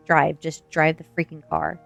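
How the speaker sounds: a contained mock scream, leaning on each word; narrated scream. A narrator's reading. Passenger orders driver to go, contained narrated scream. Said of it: "I screamed at the top of my lungs".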